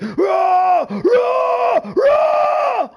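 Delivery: very high mock roaring, repeated and climbing; imitated enraged roar. Imitates the mad man's raging.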